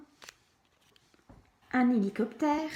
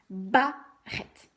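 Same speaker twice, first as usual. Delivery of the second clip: "barrette" with a loud BA, pause, rrette; stress on first syllable; 5 dB louder than usual. Shown as a demonstration. Teacher helping a child hear ba, "barrette" as BA... rrette. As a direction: punch the BA of "barrette" and detach it. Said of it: "Bien insister sur la première syllabe".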